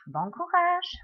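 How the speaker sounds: bright, high and loud "bon courage"; cheery sign-off. Real, not acted. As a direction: send them off with a lift.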